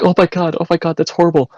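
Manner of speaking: mock a horrified listener in high pitch; horrified woman. Imitating horrified woman on phone.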